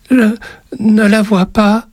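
put-on high, slow frightened child; struggling to speak. A narrator's reading. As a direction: force it out haltingly as a scared boy. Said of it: "réussit-il tout de même à dire".

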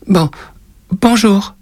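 put-on a scared boy forcing out a greeting; timid little boy. A narrator's reading. Frightened boy greets the witch. (petit garçon intimidé qui bafouille)

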